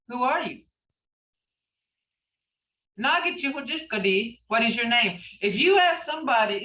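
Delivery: normal talking voice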